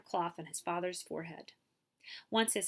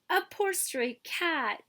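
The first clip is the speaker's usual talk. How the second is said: tender pity; 9 semitones higher, 4 dB louder, slower than usual. Acted. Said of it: "Yao He said".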